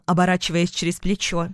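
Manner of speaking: usual speaking voice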